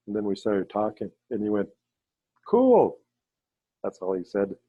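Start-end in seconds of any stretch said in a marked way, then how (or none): 2.5-3.0 high, loud, drawn-out "cool"; mimicked amazed exclamation; impressed. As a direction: blurt it out, wowed, pitch falling away. Imitates medicine man's one-word reaction.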